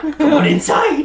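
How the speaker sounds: very high, loud clown greeting; sing-song creepy clown invitation; silly clown. Acted. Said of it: "I'm not tempted by clown voice".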